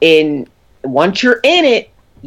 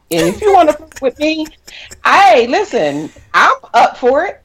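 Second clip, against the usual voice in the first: playful flirt; 10 semitones higher than usual. Pretend. Flirty offer to listeners.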